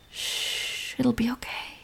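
very soft reassurance; near-whispered comfort; soothing hush. Acted. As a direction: shush and comfort them gently. Soothing crying partner.

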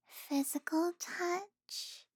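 slow, tender whisper. Acted. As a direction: let the words fall to a breath.

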